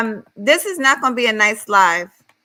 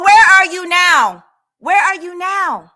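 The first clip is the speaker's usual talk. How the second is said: higher, louder taunt; taunting shout; 8 semitones higher, 6 dB louder, slower than usual. Real, not acted. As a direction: call her out, loud and high. Taunting the rival.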